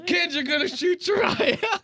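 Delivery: high, half-laughing mock shout; alarmed yell. Instructor grabs the kid's gun, high laughing mock shout. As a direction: warn him sharply, cracking up.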